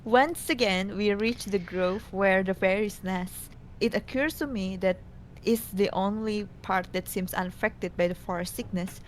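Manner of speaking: normal talking voice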